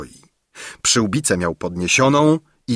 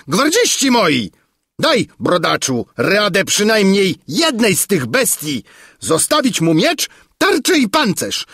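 high, raised voice of an angry king; roaring commands; 10 semitones higher than usual. A narrator's reading. King Padella ordering guards to seize the bearded man, roaring. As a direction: call the guards and order them about. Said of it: "ryknął Padella".

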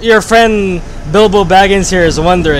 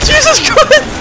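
laughing outraged yell. Real, not acted. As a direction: yell at him through laughter for saying it. Friend said forbidden word.

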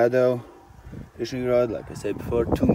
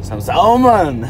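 eager hope; 9 semitones higher, 9 dB louder, slower than usual. Real, not acted. Hoping to catch salmon.

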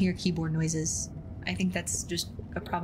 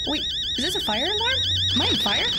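startled alarm; 14 semitones higher, 4 dB louder than usual. Real, not acted.

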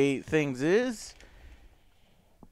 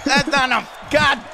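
higher, louder angry yell; frustrated yelling; 11 semitones higher, 9 dB louder, slower than usual. Real, not acted. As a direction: shout in defeat. Losing the match.

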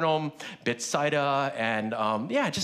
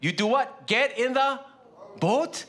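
commanding call; 8 semitones higher than usual. Retold or imitated. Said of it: "he told them".

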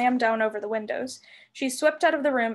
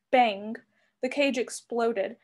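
plain reading voice

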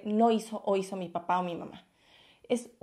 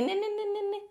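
mocking blah blah; 10 semitones higher, 4 dB quieter than usual.

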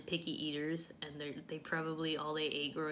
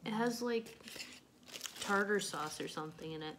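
chewing while talking; talking between bites; mouth full; 3 semitones higher than usual. Real, not acted. Seen 1: Bites burger at -5, cheeks full and jaw chewing during words.

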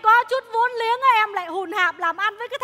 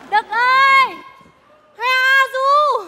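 slow loud drawn-out holler; calling out; 5 semitones higher, 8 dB louder, much slower than usual. Acted. Woman hollering to Đực on the river, slow loud. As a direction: hail him from far away.

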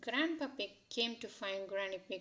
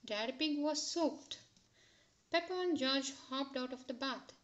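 usual speaking voice

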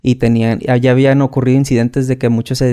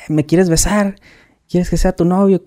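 higher-pitched mocking questions; mock indignation; 6 semitones higher than usual. Sergeant rebuffs the recruit's hug, mock indignant. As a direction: imitate a sergeant scoffing at affection.

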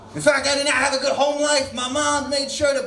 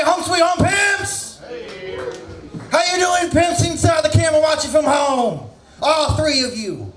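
shouted greeting; 4 semitones higher than usual. Real, not acted. Comic shouting greetings at crowd.

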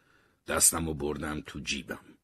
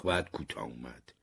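plain reading voice

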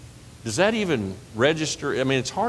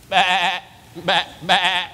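high playful baa like a sheep; sheep bleat imitation; 5 semitones higher, 3 dB louder, much slower than usual. Shown as a demonstration. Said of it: "He's got his eye on his sheep".

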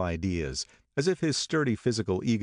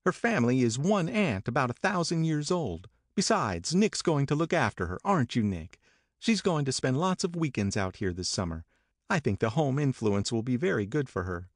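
higher, breezy Daisy; Daisy brushing off Tom, higher; Daisy voiced; 7 semitones higher than usual. A narrator's reading.

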